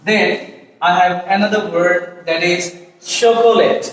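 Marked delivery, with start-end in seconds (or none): 3.2-3.9 chocolate in three syllables, "cho-ko-let"; common mispronunciation. Shown as a demonstration. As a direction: sound the middle o in chocolate. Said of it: "আমরা অনেকেই বলি এটাকে". (ভুল উচ্চারণে chocolate, "চ-কো-লেট")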